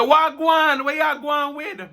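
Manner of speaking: high, loud Jamaican patois hello; Jamaican patois greeting. Retold or imitated. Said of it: "me go, me say".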